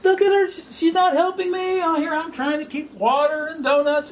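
high, exasperated whine; whiny Martha. Acted. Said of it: "and she goes".

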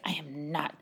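low, very soft refusal; under his breath. Retold or imitated. Boy refusing to see the manager, under his breath. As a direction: imitate a frustrated kid muttering under his breath. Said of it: "like, under his breath, really, really frustrated".

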